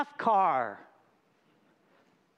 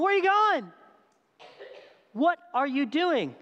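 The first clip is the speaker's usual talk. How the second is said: baffled crowd; 6 semitones higher than usual. Retold or imitated. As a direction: call after him, puzzled and let down. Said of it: "they're going".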